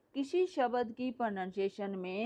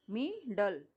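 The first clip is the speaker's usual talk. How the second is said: मी, डल as two separate beats; split into syllables; much slower than usual. Shown as a demonstration. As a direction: leave a gap between मी and डल. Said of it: "टू parts हैं".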